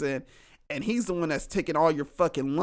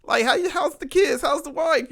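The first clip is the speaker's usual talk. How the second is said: higher, friendly catch-up voice; higher and louder, chummy greeting; friendly badger greeting; 9 semitones higher, 4 dB louder than usual. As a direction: greet a cousin warmly, pitched up. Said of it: "the honey badger's like".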